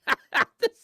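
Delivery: shrill delighted squeal; high squealing laugh. Real, not acted. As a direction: let a high shriek of laughter out. Mock-shocked reaction.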